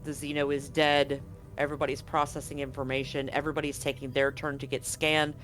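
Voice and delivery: ordinary talk, nothing marked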